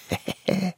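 high, hushed little giggle; snickering. A narrator's reading. Smallest savage laughs under the blanket, snickering. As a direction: chuckle smugly under your breath. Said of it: "lachte der Allerkleinste".

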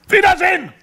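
very high, loud farewell roar; bellowing. A narrator's reading. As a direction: bellow the goodbye. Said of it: "brüllte er noch einmal".

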